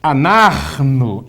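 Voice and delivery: Anarno with a rasping throaty h from deep in the throat, slow; guttural anarno. Shown as a demonstration. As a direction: scrape the middle of Anarno out of the throat. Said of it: "deixa rasgar a garganta mesmo".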